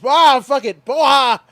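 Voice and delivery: loud, high imitation of a fighter's victory shout; mock fighter yell. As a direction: yell it like a cocky winner celebrating. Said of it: "he goes".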